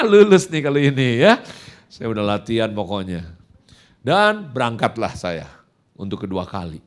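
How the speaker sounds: usual speaking voice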